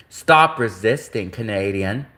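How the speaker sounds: low, slow mock cop voice; slow retold command, sinking in pitch; police officer impression. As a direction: imitate a cop taunting, drawn out and low. Imitates the taunting police officer.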